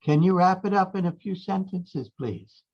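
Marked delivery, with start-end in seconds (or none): none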